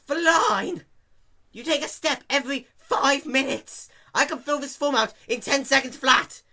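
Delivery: high, loud indignant outburst; exasperated. A narrator's reading. Dash arguing with the clerk, high loud exasperation. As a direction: rant at the absurd clerk. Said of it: "Dash explained".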